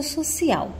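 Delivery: social, weight on its last syllable; stress on last syllable. Shown as a demonstration. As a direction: lean on the end of social. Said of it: "o au, ela tem a maior intensidade".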